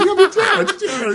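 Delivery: very high, squealing mock teenage girl; fourteen-year-old girl. Retelling sitcom girlfriend's tiara delight, mock teen squeal. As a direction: squeal it giddily, with laughter, like a young girl. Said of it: "completely goes fourteen-year-old girl".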